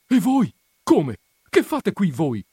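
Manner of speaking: loud, high burst of surprise; astonished questioning. A narrator's reading. Perpetua recognising the other couple, loud astonished questioning. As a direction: demand it in astonishment. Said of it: "domandò Poscia all'altra coppia".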